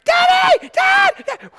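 put-on high loud child shouts of daddy; kids yelling for dad. His kids calling from outside, high shouted daddy. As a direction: call out like a scared kid. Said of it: "I hear".

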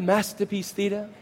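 put-on English accent, quick and softly said; mock-posh English, like a TV programme title. Preacher joking with the congregation about a show, English accent. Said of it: "it has an English accent".

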